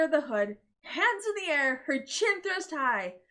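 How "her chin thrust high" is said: usual speaking voice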